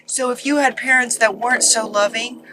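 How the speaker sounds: normal talking voice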